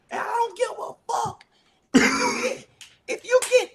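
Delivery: high, loud mock coach rant; crazy coach yell. Coach yelling at a late freshman, high loud rant. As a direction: bellow it like a raging coach. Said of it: "he just got crazy. Like".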